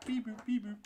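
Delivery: high beeping robot droid sounds; droid beeps. Pretend. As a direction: beep like a little droid. Imitating a droid.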